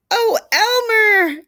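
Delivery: high, slow gasped Oh, Elmer. Acted. Old elephant gasps as Elmer's patchwork reappears. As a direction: gasp it out in delighted surprise. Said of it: "gasped an old elephant".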